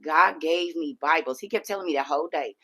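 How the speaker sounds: usual speaking voice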